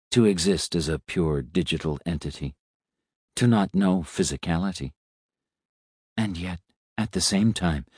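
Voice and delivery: put-on calm, quiet old robot; soft robot voice. A narrator's reading.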